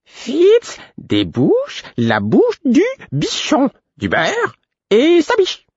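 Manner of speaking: very high, slow, word-by-word insistence; drawn-out emphatic fluting; energetic, slightly cracked piping. A narrator's reading. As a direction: hammer out each word in a high fool's voice. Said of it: "répéta-t-il énergiquement".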